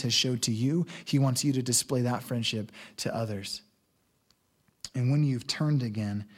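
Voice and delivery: quiet, heartfelt sermon close; tender preaching. Real, not acted. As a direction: gently encourage the hurting listener. Tender closing appeal to congregation.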